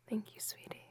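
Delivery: hushed close-up thanks; whispering. Acted. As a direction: breathe the thanks softly.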